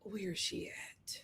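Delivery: hushed whisper while searching; whispering to herself. Real, not acted. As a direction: murmur it under your breath. Searching for her picture.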